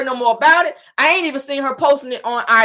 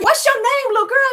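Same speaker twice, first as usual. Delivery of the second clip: grown-up asking. Retold or imitated. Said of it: "That's not".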